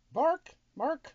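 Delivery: very high, silly backwards talk; backwards speech. Pretend. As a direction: squeak it out like a dog. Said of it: "I'll speak backwards to your dog".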